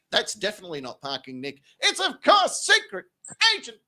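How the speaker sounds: booming host introduction; ring announcer. Acted. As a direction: build the suspense to the name. Announces second guest.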